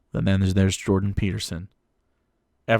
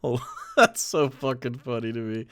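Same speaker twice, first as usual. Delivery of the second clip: laughing through the words; tickled, barely getting it out; cracking up; 3 semitones higher than usual. Real, not acted. Cracking up at the cosplay.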